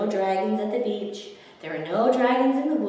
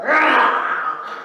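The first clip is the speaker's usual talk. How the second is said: loud playful "Rah" with a laugh; boy's roar; 5 semitones higher, 8 dB louder than usual. A narrator's reading. Boy roars at Theodore to tease him, playful roar. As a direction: roar like a kid pretending to be scary. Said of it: "The boy roared at Theodore".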